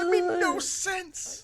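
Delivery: high, slow, laughing through it; laughing in disbelief. Real, not acted. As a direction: drag it out between laughs. Laughing fit.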